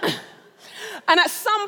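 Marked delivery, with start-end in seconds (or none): 0.0-0.2 a huge, much higher wail of a shout; desperate cry. Retold or imitated. Competing with the keyboard player, a high desperate shout. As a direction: belt it out over the band like a desperate child. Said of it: "and I'm like".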